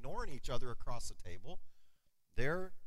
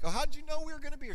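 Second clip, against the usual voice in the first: fast, higher, tumbling surprised question; surprised reply; 10 semitones higher, much faster than usual. Retold or imitated. Running into his sister, fast surprised reply. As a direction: blurt it out in quick surprise. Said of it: "I'm like".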